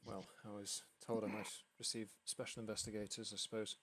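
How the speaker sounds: soft-spoken, reserved man of the house; lightly voiced father, quietly sizing up visitors; weary father, hesitant. Game master voices the worried father.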